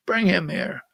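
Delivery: put-on hushed, half-hearted Russian accent; mock Russian accent. Host mimicking Putin, soft bad Russian accent. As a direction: murmur it like a bad Putin impression. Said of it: "That's not a Russian accent".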